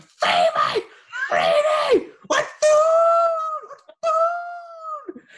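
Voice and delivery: very high, loud calling out; frantic calling. Retold or imitated. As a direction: call the lost dog desperately down the street. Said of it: "walking around the neighborhood".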